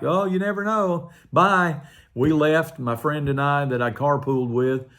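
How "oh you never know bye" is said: high, flippant "never know", then a loud bye; tossing it off lightly and leaving; breezy brush-off. Retold or imitated. Telling the boss he's leaving anyway, breezy. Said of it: "And I was like".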